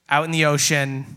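ordinary talk

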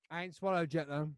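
ordinary talk, nothing marked

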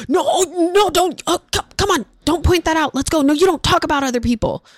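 high, stammering mock panic; hammed-up embarrassed hushing; mortified parent. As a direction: act out a flustered parent hurrying a child away. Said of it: "we are just mortified and we run".

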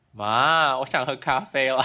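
put-on playful Taiwanese accent to mom; teasing in a Taiwanese lilt. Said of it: "我就会突然间". (台湾腔撒娇)

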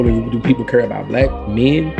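ordinary talk, nothing marked

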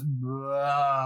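long drawn-out "blaah"; Ralphie's long blurt. Retold or imitated. Speaker imitating Ralphie in A Christmas Story, long blurted noise. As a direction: imitate a stream of babble spilling out in one long blurt. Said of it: "where it just goes".

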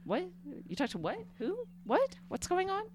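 baffled little squeak; mock confusion. Friend playing dumb at "nanner" in mock confused squeaks.

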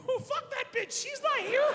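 high, silly voice for own past self; squeaky mock voice. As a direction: squeak it out like a giddy kid. Dad voicing himself, mocking mom.

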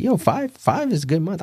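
high-pitched mimicry of the other guy; high, light imitation; mock upbeat voice. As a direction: pitch it way up, quoting him lightly. Voices the other man's upbeat opinion.

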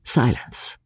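usual speaking voice

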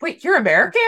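high, loud startled disbelief; retold surprise. Friends catching a fake Brit: loud surprised disbelief. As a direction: blurt it out, caught off guard.

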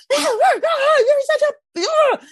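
high-pitched garbled rant; hammed-up, strangled furious shriek; mock screaming. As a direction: imitate screaming in a fit of irritation. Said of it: "like, screaming".

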